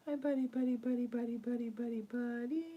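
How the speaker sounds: sing-song cooing to a pet; pet talk. Real, not acted. Owner greeting her pet buddy, sing-song coo. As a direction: coo the name over and over.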